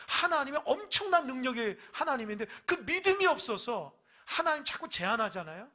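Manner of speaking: high, loud shouted sermon; fiery preaching. Acted. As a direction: preach it at full force. Preaching at full force.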